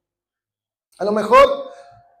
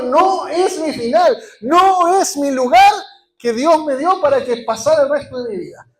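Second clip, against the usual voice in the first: loud congregational declaration; 5 semitones higher, 8 dB louder than usual. Shown as a demonstration. Pastor leading the church to repeat after him, loud. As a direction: lead the crowd in a shouted creed. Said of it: "Usted tiene que decir conmigo".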